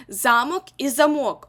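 first Замок stressed on the first syllable ЗА, second замок stressed on the last syllable МОК; stress contrast. Shown as a demonstration. Teacher shows students that stress changes the meaning of Замок. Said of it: "за наголосом".

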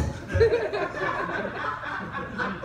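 high airy wheeze of laughter; cracking up at the joke; breathless laugh. Real, not acted.

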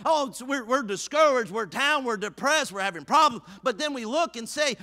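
high, loud, driving exhortation; fired-up preaching. Real, not acted. Preacher urging congregation to bring their jugs, fired-up preaching. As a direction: preach it hard in pounding phrases.

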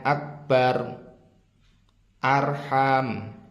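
akbar and arham with a heavy, full r; thick r. Shown as a demonstration. Teacher shows learners the tafkhim rule, thick r. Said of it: "ini dibaca tebal nanti".

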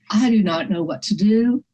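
ordinary talk, nothing marked

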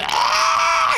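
very high mock squeal; imitated scream. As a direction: mimic the toy's scream. Imitates noisy game character. (chillido imitado)

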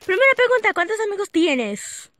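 loud announcing. Real, not acted. Loud announcement of first question.

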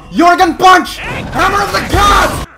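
very high, loud battle call; mock attack cry. Player calling out his attacks, high hammed-up shouting. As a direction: shout it like naming a special move. Calls out attacks mid-fight.

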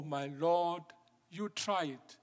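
ordinary talk

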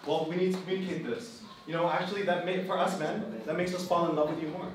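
usual speaking voice

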